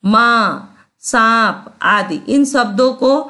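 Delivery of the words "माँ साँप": "माँ, सांप" with long vowels through the nose; nasalised vowels. Shown as a demonstration. Teacher gives chandrabindu examples, nasal vowels in "माँ, सांप". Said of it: "स्वर को नाक से बोला जाता है".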